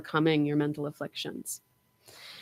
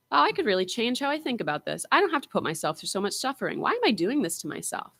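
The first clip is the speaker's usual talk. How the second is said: higher, quicker, softer voice of sudden self-realization; inner voice; 6 semitones higher, 4 dB quieter, faster than usual. Retold or imitated. Teacher voicing a student's inner realization, lightly mocked. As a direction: voice yourself catching the thought, light and quick. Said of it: "how often do you stop yourselves and go".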